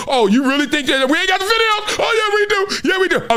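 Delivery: very high, excited mock yell; hammed-up shouted comeback. Insisting to the trash talker they got the video, mock yelling. Acted quarrel reaching a shout.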